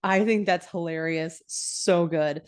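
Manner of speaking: ordinary delivery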